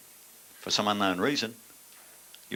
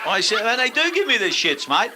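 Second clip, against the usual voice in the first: very high, loud outburst; exasperated rant; 7 dB louder than usual. Real, not acted. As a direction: let the exasperation burst out at top pitch. Heated insistence, ranting on stage.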